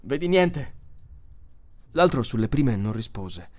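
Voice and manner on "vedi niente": anxious, higher-pitched question; agitated. A narrator's reading. Donovan to Powell as he scans the horizon, agitated. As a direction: ask with an urgent, agitated lift. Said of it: "lo osservava in preda all'agitazione".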